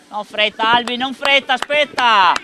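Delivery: high loud coaching yell; urgent shout. Real, not acted. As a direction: shout at the player to wait. Coaching player from sideline.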